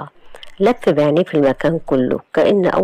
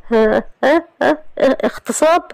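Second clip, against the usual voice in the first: shocked stammer; 9 semitones higher than usual. A narrator's reading.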